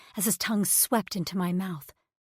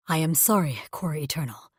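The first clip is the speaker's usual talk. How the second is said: low, subdued apology from a lightly voiced creature mate; alien mate; 4 semitones lower than usual. Alien mate apologizing to his angry pregnant partner, low and subdued. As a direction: lower the voice, a hint of a hurt alien.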